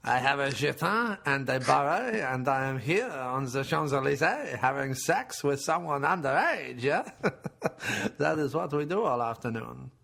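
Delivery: hammed-up French accent. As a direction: boast leisurely in mock French. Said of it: "I'm French".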